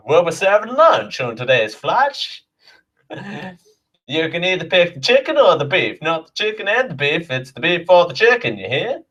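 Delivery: hammed-up Southern US air hostess safety briefing; a mock Southern US flight attendant walking through safety; Southern US accent. Air hostess briefs passengers in a hammed-up Southern US accent. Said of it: "could you imagine me going".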